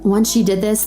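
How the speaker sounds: ordinary talk, nothing marked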